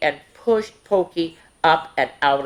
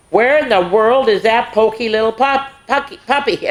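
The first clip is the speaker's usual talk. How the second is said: calling out; 5 semitones higher, 4 dB louder than usual. Acted. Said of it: "everyone wondered".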